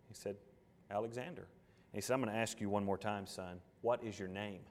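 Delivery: low, quiet storyteller voice; story narration. A narrator's reading. Preacher tells the Alexander story to the church, low and quiet. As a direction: draw them into the tale softly.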